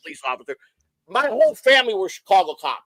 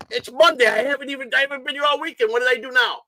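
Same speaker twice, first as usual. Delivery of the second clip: put-on higher, whiny kid pleading innocence; protesting kid; 8 semitones higher than usual. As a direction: play your younger self, indignant and high. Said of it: "I go".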